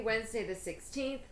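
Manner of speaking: ordinary talk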